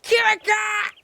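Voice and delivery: very high, loud name shout; squeaky call. Pretend. Streamer calls out to viewer Kirika, high squeaky shout. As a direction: squawk the name in a put-on voice.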